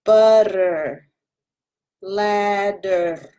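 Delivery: usual speaking voice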